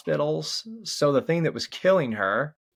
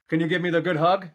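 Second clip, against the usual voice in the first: asking for a hug; 6 semitones higher, 4 dB louder, faster than usual. Real, not acted. Father asks his son for a good hug, playfully.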